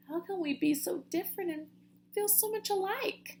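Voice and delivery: soft, high wondering; musing. Acted. Flitter wondering at their friendship, soft musing. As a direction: muse softly aloud. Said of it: "mused Flitter".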